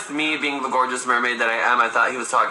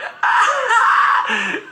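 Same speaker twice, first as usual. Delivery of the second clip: shrieking burst of laughter; loud laugh; 5 dB louder than usual. Retold or imitated. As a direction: howl with laughter. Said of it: "Chris would have been like".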